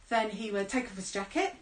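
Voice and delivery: ordinary delivery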